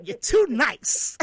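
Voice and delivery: exasperated retold voice, leaning on TOO NICE; emphatic mock scolding. Retold scolding, louder and drawn out.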